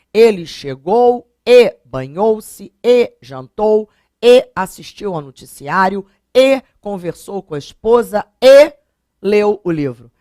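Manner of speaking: every "e" hit loud and drawn, slow pace; ênfase nos e. Shown as a demonstration. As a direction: hammer each "e" to show the repetition. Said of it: "Eu usei várias vezes". (cada "e" marcado, alto e lento)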